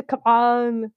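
action-hero catchphrase in a put-on accent; Arnold Schwarzenegger. Shown as a demonstration. Coaching co-host on starting the impression, hammy action hero. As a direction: demonstrate the star's way into the voice. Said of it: "You have to start with the".

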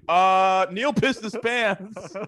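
high, slow, laughing confession. Retold or imitated. Telling the locker room while cracking up, high laughing confession. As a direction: squeeze it out through laughter.

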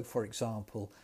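ordinary talk

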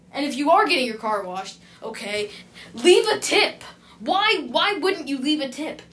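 loud, high exasperated rant; angry rant. Acted. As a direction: scold the customers who don't tip. Rant about customers not tipping.

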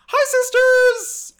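put-on squealing high girly greeting; bubbly sorority girl. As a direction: shriek it like an excited sorority sister. Opening skit greeting fans.

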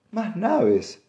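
high-pitched heated echo. A narrator's reading. Wife repeats her husband's words, heated and high. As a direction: throw the words back, high and indignant. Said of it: "repitió ella acalorada".

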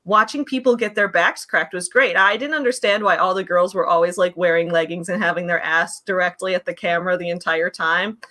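ordinary delivery

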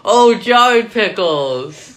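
high, loud, very slow drawn-out realization; mock dawning. Cohost retelling her realization, drawn out. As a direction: stretch it out like it finally clicks. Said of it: "you're like".

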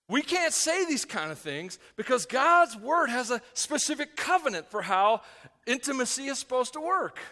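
loud, high, emphatic preaching; forceful preaching. Real, not acted.

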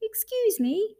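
very high, small bear voice; polite Little Bear. Acted. Little Bear interrupts the creature, high polite voice. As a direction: pipe up politely, pitched right up.

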